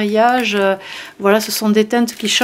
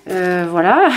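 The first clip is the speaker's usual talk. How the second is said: ordinary talk, nothing marked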